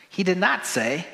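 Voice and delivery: usual speaking voice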